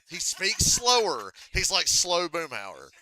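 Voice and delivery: loud, high and drawn out; emphatic. Real, not acted. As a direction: stretch it out loudly to hammer the point. Emphatic point about slow speech.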